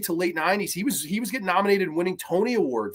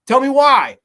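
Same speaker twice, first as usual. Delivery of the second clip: bored dismissive; 5 semitones higher, 6 dB louder than usual. Retold or imitated. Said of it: "I was like".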